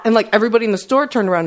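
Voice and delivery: ordinary talk, nothing marked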